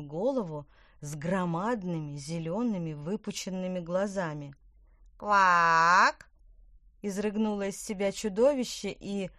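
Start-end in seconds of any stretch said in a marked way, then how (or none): 5.2-6.3 loud croak. Acted. Frog king croaks.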